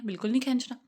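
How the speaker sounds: ordinary talk, nothing marked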